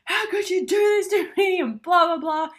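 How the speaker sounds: furious partner yelling over dirty dishes; imitated screaming. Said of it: "you're, like, screaming at them".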